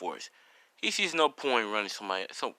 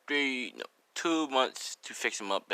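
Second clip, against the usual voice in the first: usual speaking voice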